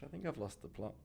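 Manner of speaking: normal talking voice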